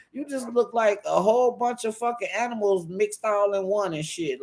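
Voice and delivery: ordinary talk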